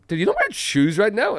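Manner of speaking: incredulous high-pitched question; mock astonishment. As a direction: play a stranger who can't believe it. Retelling a stranger's shocked question.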